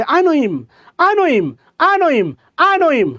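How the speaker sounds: I know him with him said as im, no h; h dropped in him. Shown as a demonstration. Teacher drills the class on a dropped-h him. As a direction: run know him together as noi-m. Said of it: "উচ্চারণ করেন".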